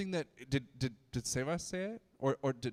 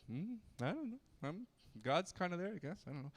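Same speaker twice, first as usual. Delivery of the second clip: softer, higher, aloof mumble; lightly imitating an aloof person; aloof shrug; 3 semitones higher, 7 dB quieter, faster than usual. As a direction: sound like you barely care. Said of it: "Just kinda like".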